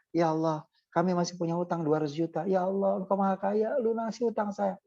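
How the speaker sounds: ordinary talk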